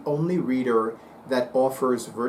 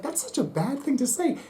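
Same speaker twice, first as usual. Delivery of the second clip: much higher, mock-scandalized at his own joke; mock self-scolding; 11 semitones higher, 3 dB quieter than usual. Psychic mock-scolding himself after teasing the baker. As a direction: chide yourself in a squeaky mock gasp. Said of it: "I was like".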